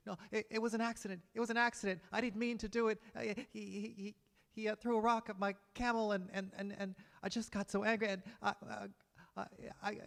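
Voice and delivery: high, stammering, desperate voice begging for his life; a terrified man tripping over his words; panicked pleading camel driver. Acted.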